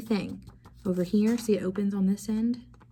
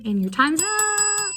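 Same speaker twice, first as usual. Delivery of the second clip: high, bright time's up, much higher and slower; sing-song end of the countdown; playful announcement; 13 semitones higher, 4 dB louder than usual. Acted.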